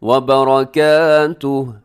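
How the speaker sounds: slow, loud बरकातुहु with a thick र; tajweed recitation; heavy ra. Shown as a demonstration. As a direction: darken the र in बरकातुहु. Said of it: "रॉ आपने मोटा पढ़ना है".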